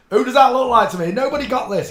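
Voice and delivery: high, loud, fast disbelief; triumphant find; excited shout. Real, not acted. Thrilled nobody else matched this face, loud and excited.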